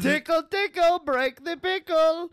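high, loud singsong dad; silly dad voice. Acted. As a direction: tease it high and slow. Playing the dad.

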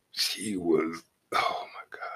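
breathless whispered "oh my God"; awed whisper. Retold or imitated. Stunned by how she looked, awed whisper. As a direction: whisper it in disbelief.

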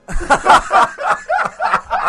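high-pitched laughter; real laughter. Real, not acted. Laughs after joke.

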